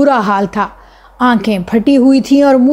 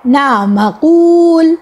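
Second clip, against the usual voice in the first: higher, slow drawn-out answer; a girl playing the magician's subject, slow and higher; girl in a trance; 4 semitones higher, much slower than usual. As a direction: answer like a dazed hypnotised girl. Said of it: "بولی".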